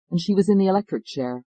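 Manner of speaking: plain reading voice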